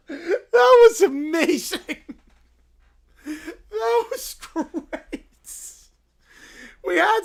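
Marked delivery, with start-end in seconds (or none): 0.0-5.3 high squealing laugh, words slow through it; can barely get the words out for laughing; laughing hard. Real, not acted. Host cracking up after the bit.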